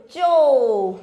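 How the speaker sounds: चो loud, with the "oh" held; teacher's model चो with a stretched "oh"; long O sound. Shown as a demonstration. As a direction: draw out चो as "chohh". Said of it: "तो क्या पढ़ेंगे इसको आप".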